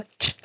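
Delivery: "ch" as a short soft tch; ch sound. Shown as a demonstration. Teacher sounding out the ch digraph. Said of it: "forma el sonido".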